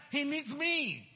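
ordinary talk

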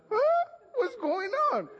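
put-on wide-eyed kid who can't believe it; startled boy. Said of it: "I was wondering".

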